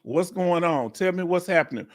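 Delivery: usual speaking voice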